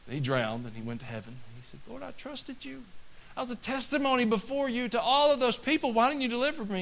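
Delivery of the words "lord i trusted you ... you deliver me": much higher put-on aggrieved voice; whiny complaint. Drowned man complaining to God in heaven, high whiny voice. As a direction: plead like you've been cheated.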